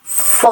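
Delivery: soft, airy फ as f; English f. Shown as a demonstration. Teacher showing air escaping, breathy English फ. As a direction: blow air out on फ. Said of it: "हवा निकल रहा है".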